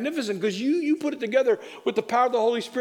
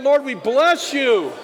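loud prayer; 5 semitones higher, 4 dB louder than usual. Acted. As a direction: lead the church in a loud, fervent prayer. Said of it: "Can we just say that?".

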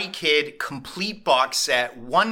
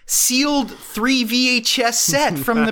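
put-on high old-lady enthusiasm; grandma voice; 11 semitones higher, 5 dB louder than usual. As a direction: sell it like a proud grandma. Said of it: "She's like".

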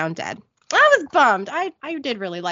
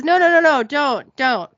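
pleading; 5 semitones higher, 5 dB louder than usual. Retold or imitated. Cyrus cowers at gunpoint.

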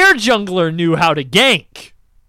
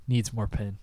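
quiet taunt; 10 semitones lower, 15 dB quieter than usual. Retold or imitated. Muttering taunt in game.

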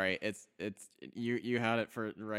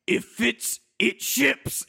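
much higher, much louder, hammed-up commercial pitch; mock ad slogan; 11 semitones higher, 11 dB louder, slower than usual. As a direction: shout the slogan like a cheesy mail ad. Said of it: "where Kath's like".

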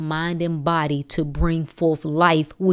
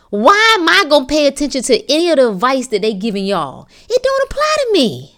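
high, loud indignant protest; 6 semitones higher, 5 dB louder than usual. Real, not acted. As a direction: throw the advice back with rising pitch and force. Indignant rejection of mainstream advice.

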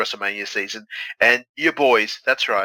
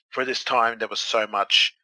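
ordinary talk

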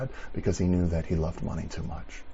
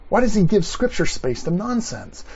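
much higher, louder outraged question; indignant preaching; 10 semitones higher, 4 dB louder than usual. Acted. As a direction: demand an answer from the congregation.

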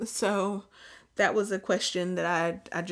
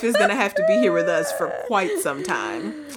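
mock evaluator verdict; 5 semitones higher, 6 dB louder than usual.

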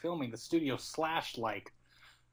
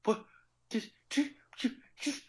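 stunned stammer; 9 semitones higher than usual. Retold or imitated. Stunned quadruple take.

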